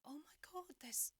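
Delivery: breathless high whisper of shock; shocked gasp. Retold or imitated. Teen seeing a homeless boy, shocked whisper. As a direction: whisper it in disbelief, pitch jumping up. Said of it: "I was like".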